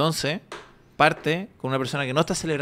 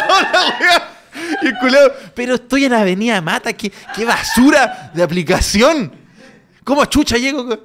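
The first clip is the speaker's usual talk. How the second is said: laughing annoyed rant; 8 semitones higher, 9 dB louder than usual. Acted. Delivery guy voiced, laughing rant.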